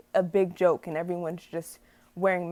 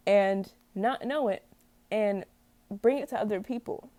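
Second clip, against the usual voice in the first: ordinary delivery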